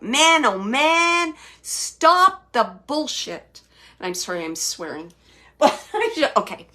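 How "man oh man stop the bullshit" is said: loud, high and very slow reproach; exasperated quote. Retold or imitated. Medium repeating spirit's exact words, emphatically exasperated. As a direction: drag out each word emphatically. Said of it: "that's exactly a quote".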